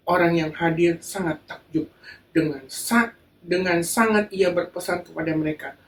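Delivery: ordinary talk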